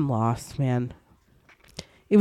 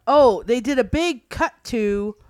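ordinary talk, nothing marked

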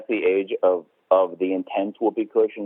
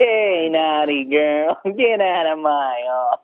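slow, higher put-on old man voice; gravelly old tycoon voice; 4 semitones higher, slower than usual. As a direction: drag out each word like a creepy old boss. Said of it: "That voice does not lend well".

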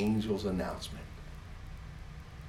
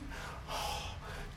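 joyful gasp; 11 dB quieter than usual. Acted. God gasping.

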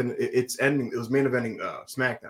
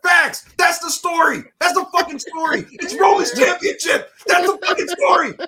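high, loud fan outburst; ecstatic shouting; 12 semitones higher, 7 dB louder than usual. Retold or imitated. Fan reliving his cheers for the promo, ecstatic shouting. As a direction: yell it like total vindication.

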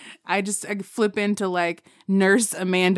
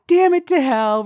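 higher, louder exasperated curse; annoyed curse; 7 semitones higher, 6 dB louder than usual. Retold or imitated. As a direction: vent the irritation loudly. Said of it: "I was pissed".

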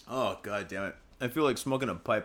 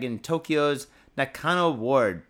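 "Nakano" read slow and louder in announcer style; news read; 5 dB louder, slower than usual. Acted. Reading the article's location aloud to viewers, slow announcer voice. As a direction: give "in Tokyo's Nakano Ward" a slow, loud news-read delivery.

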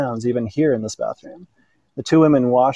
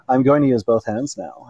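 usual speaking voice

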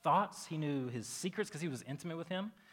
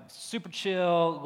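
super chill stretched slowly, louder; drawn out; 4 semitones higher, 7 dB louder, much slower than usual. Shown as a demonstration. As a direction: lean into "chill" slowly and loosely. Repeats phrase, drawn out.

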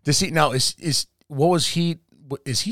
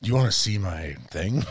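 mock seductive; 8 semitones lower, 7 dB quieter than usual. Mock seduction in the urinal scene.

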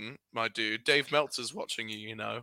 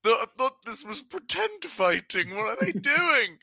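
high, baffled naive voice; puzzled innocent asking; confused newcomer; 9 semitones higher, 5 dB louder, slower than usual. Retold or imitated.

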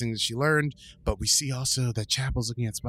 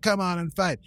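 louder, higher call to fight; mock shouted challenge; 7 semitones higher, 4 dB louder than usual. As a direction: bait the monster, half-shouting. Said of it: "She's shouting the challenges".

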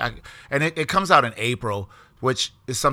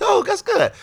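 very high, light acknowledgement; high-voiced little "oh" of approval; retold pleased reply; slower than usual. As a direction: pitch it way up, mock pleased. Retells own pleased reply back then.

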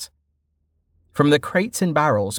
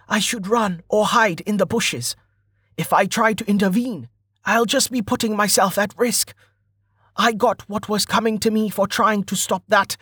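higher-pitched anxious muttered thought; inner monologue; 8 semitones higher than usual. A narrator's reading. Rider talking himself out of helping, higher voice. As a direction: reason it out to yourself, pitched up. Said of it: "Stint cursed under his breath".

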